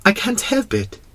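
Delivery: put-on small, high child's voice; high, sheepish young prince; embarrassed little prince. A narrator's reading. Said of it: "quite embarrassed".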